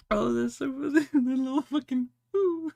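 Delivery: squeaky high, breathless laughter through the words; can't stop laughing while reacting to the picture; laughing fit. Real, not acted. Laughing fit over a funny picture of the Elves.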